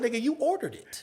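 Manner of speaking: high, soft, amused retort; punchline through laughter. Shown as a demonstration. Comic delivers his weight joke, high amused punchline. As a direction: barely hold the laugh back.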